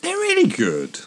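mock fluctuation. Host mocks a friend's love of voice fluctuation, swooping high. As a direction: overdo the wobbly voice.

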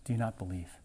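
a tender question from a loving father; God asking gently. Retold or imitated. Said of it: "He's gonna ask".

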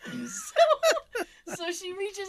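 bursting into laughter on the word; laughing. Real, not acted. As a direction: laugh right through it. Laughing while retelling.

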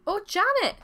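high, loud cry of surprise; surprised cry. A narrator's reading. Man spots the bannock and calls his wife, surprised cry. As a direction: call out to the wife in astonishment. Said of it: "cried out in surprise".

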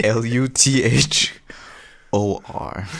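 slow letter-by-letter spelling; pointed spelling. Acted. Luthor spells his name for the boy, slow and pointed. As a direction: spell each letter slowly and forcefully.

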